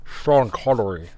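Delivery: slow, put-on Connery voice; Sean Connery impression. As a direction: drawl the name like Connery. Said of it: "My best".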